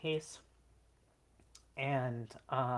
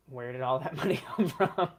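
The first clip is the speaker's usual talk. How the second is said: laughing; 2 semitones higher, 9 dB louder than usual. Retold or imitated. Roommate reacts to money, laughing.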